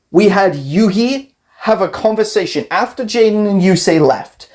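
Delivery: ordinary delivery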